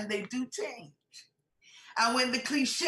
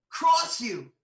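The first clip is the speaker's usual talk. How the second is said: sudden louder burst; louder jab to grab the room; punched out; 7 dB louder than usual. Acted. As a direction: hit it hard and loud. Preacher punching a point.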